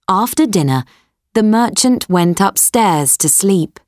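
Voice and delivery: ordinary delivery, nothing marked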